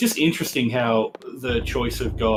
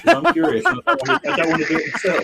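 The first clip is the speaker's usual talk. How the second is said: squeaky high excitement; 5 dB louder, faster than usual. Real, not acted. Excited request to friend.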